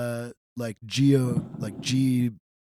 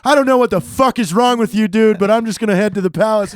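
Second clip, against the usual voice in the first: retold loud comeback; 6 semitones higher, 7 dB louder than usual. Airman answers back to shouting sergeant.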